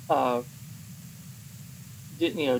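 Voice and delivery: ordinary talk, nothing marked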